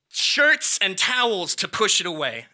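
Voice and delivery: usual speaking voice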